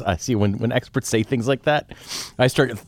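ordinary delivery

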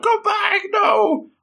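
very high-pitched, drawn-out mock yell; mock shout. Hide calls after the fleeing waitress, mock shouting. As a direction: call after her in a squealing pretend shout.

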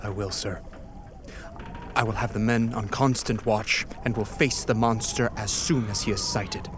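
low, sober pledge; grave resolve. Acted. Captain promising the dying man to face the monster, grave resolve. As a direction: answer low and steady, like an officer taking orders.

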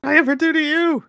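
very high, loud mock outcry; high-pitched protest. Attacked adventurer protesting to the dwarf, high mock-indignant yelp. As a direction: yelp it in an exaggerated squeal.

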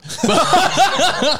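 squealing high laugh; real high-pitched laugh. Real, not acted. Laughs at the joke.

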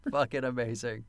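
ordinary delivery

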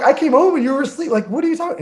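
high, rushed self-defense; quick, high-pitched mock bewilderment; retold baffled protest.

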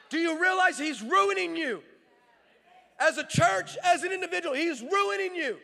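stirring the congregation to risk it all; fiery preaching. Acted. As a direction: preach it to the whole church. Preaches at full force.

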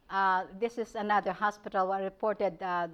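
ordinary talk

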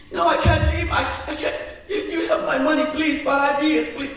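high pleading; desperate begging. Acted. Sweeper begging not to leave.

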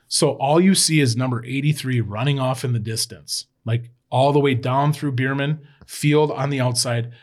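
ordinary talk, nothing marked

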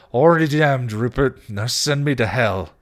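loud, high, bitter retort; defiant outburst. Acted. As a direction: throw it back at full volume. Defiant taunt in quarrel.

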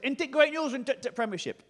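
high, excited northern English fan; stammering, overjoyed Huddersfield accent; generic northern accent. Retold or imitated. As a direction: blurt out the good news in broad Huddersfield. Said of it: "my generic northern accent".

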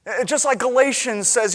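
ordinary talk

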